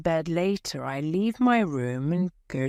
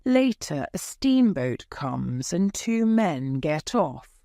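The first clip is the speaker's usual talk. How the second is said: ordinary delivery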